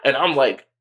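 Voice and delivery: ordinary delivery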